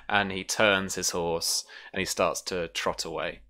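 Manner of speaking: usual speaking voice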